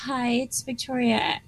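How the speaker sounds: very faint, distant voice; barely audible. Real, not acted. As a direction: speak so softly you're hard to hear. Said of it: "female with a very faint voice".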